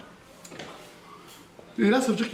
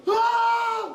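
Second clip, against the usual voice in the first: retold scream. Father panicking at the clinic doors, high scream. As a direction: let out a sudden panicked cry. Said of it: "Je commence à crier".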